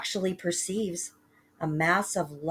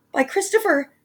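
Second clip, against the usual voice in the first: much higher, loud cry; shocked cry; 12 semitones higher, 6 dB louder than usual. Acted. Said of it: "Jack cried".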